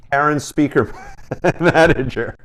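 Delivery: cracking up before "Manager"; breaking into laughter. Real, not acted. Host naming the guest's Karen pedal, breaking into laughter. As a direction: try to say the name and laugh midway. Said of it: "I can't say that without laughing".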